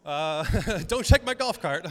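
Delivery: loud laughing tease; laughing joke. Retold or imitated. Pastor retelling how he joked with the officer at the food trucks, laughing. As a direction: tease him through a laugh.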